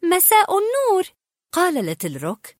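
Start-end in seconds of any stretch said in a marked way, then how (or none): 0.0-1.0 high, drowsy sleepy reply. A narrator's reading. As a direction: answer still half asleep. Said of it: "ابتسمت آنا ابتسامة ناعسة".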